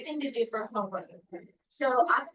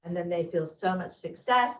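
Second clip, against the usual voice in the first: usual speaking voice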